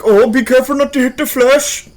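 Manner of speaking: put-on a playful Pakistani accent. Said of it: "a Pakistani accent".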